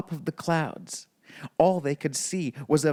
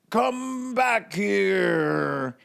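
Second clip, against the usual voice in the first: higher, drawn-out very slow roar; giant roaring; 5 semitones higher, much slower than usual. A narrator's reading. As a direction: bellow it long like an angry giant. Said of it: "he roared".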